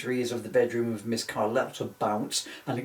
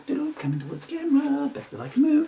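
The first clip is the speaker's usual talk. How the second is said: squeaky little voice; 13 semitones higher, 3 dB louder than usual. Pretend. Squeaky voice toward camera.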